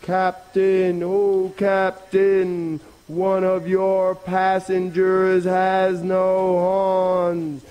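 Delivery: high, sustained chanting call. Acted. Rabbit hailing the passing boat from a hill, calling out. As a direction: hold each word long on one high note. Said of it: "he called out".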